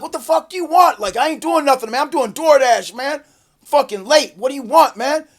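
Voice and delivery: high, loud complaining; retold exasperated protest. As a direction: act out hassled indignation at police. Retold protest at armed cops.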